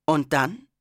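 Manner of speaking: usual speaking voice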